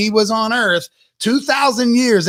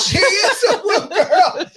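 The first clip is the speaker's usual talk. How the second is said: laughing; 6 semitones higher, slower than usual. Real, not acted. Agreeing laughing.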